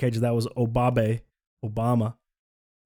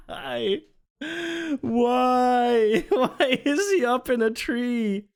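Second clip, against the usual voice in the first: high-pitched laughing through the words; laughing hard; 13 semitones higher, slower than usual. Real, not acted. Streamer reading chat, laughing high and helpless. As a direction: crack up, squeaking with laughter.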